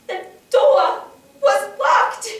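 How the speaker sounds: high, loud, word by word; anguished cry. Acted. Survivor trapped at the shut door, anguished shout. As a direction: cry it out in desperation.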